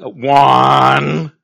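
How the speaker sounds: booming shouted count; thunderous count. Retold or imitated. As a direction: boom it like God's thunder. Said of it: "did you hear the thunder".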